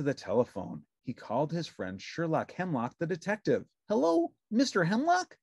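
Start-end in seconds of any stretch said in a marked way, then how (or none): 3.9-5.4 high, bright puppet voice; cheerful little puppet; Ernie voice. A narrator's reading. Narrator voicing Ernie answering the phone, high puppet voice.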